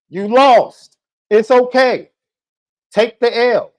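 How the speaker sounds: high, loud mocking jeer; shouted gloating; loud taunt. Real, not acted. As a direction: rub the loss in his face. Taunting the podcast critic.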